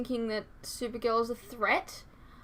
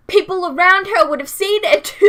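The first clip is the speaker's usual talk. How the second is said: loud, high-pitched protest; exasperated outburst; 7 semitones higher, 14 dB louder than usual. Real, not acted. Reactor arguing back at a comment, loudly exasperated. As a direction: burst out much louder and higher, driving the point home.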